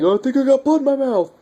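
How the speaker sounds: freaking out. Retold or imitated. Survivor panics after a zombie fight, high rushed freak-out. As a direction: squeal it out fast like you just realised you're infected. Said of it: "And he starts freaking out".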